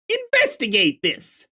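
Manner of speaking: a hammed-up investigate command; emphatic command. Shown as a demonstration. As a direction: order it with mock urgency.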